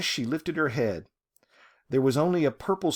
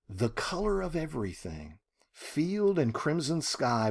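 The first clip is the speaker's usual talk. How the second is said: ordinary delivery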